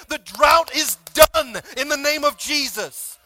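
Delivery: loud, driving preacher's exhortation; rousing sermon climax; preaching at full force. Acted. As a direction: build the crowd up, full voice.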